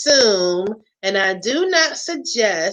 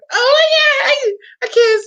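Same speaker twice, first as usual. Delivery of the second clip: high, loud excited cry; excited shout; 4 dB louder than usual. Real, not acted. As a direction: burst out with glee. Sudden excited outburst.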